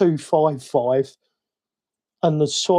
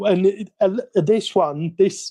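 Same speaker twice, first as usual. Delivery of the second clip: usual speaking voice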